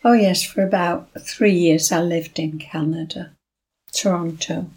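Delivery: ordinary talk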